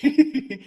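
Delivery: quick high laugh; amused chuckle; short laugh. Real, not acted. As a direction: laugh at the joke. Laughs at name.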